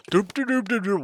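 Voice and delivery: high-pitched silly doop-doop; mocking doop noises. Coach imitating clueless teammates walking, mocking singsong doops. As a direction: babble mock footstep doops in a high voice.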